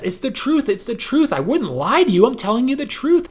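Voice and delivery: pleading liar. Retold or imitated. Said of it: "People who lie will repeat things".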